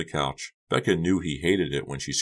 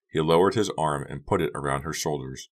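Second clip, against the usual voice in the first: ordinary delivery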